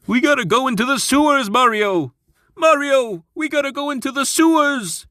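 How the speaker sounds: Luigi voice. Acted. Luigi voice, Mario plumbers skit.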